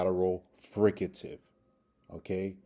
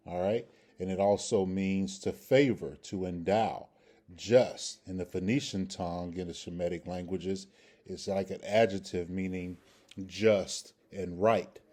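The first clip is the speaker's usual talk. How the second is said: ordinary talk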